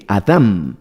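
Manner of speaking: Adam with stress on the second A; Hebrew pronunciation. Shown as a demonstration. Preacher repeating the Hebrew text's word, Adam with final M. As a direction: land on the last syllable of Adam, closing on M. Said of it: "con un acento en la segunda A y con M".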